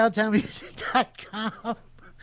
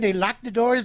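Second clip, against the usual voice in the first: usual speaking voice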